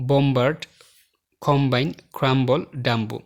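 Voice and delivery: "বম্বার্ড, কম্বাইন, ক্রাম্বল, ডাম্বো" with B heard after M; teacher's demo of "বম্বার্ড, কম্বাইন, ক্রাম্বল, ডাম্বো" with audible B; sounded B. Shown as a demonstration. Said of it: "বি কিন্তু উচ্চারিত হয়েছে".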